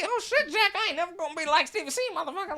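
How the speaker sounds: very high, strained caricature; hammed-up, high-pitched comic character; mock comedian voice. Dream comedian losing to the teller, high mock voice.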